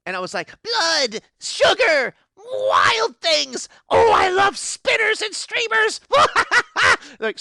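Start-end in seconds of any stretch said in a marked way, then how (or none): 0.6-6.0 high, gushing pixie glee; giddy excitement. Acted. Pixie gushing over favourite things. 6.0-6.9 high, bubbling fairy giggle; giddy pixie laugh. Acted.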